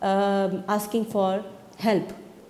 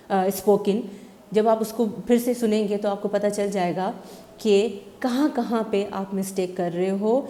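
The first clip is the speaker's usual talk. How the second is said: ordinary talk, nothing marked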